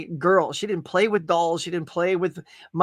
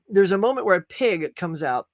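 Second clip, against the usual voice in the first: ordinary talk, nothing marked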